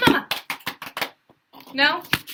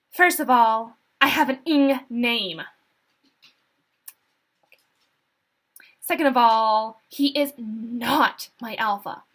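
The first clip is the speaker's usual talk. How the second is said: loud, emphatic defiance; defiant retort; 6 dB louder than usual. A narrator's reading. Human prisoner refusing the Alpha's authority, loud and defiant. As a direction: push back firmly, punching each point.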